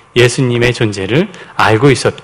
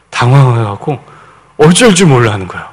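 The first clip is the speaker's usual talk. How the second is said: ordinary talk, nothing marked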